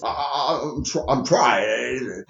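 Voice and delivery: slow, stammering put-on voice; halting Jimmy Stewart-style stammer; Petey Stewart. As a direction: stutter it out like a struggling student. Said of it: "That's Petey Stewart again".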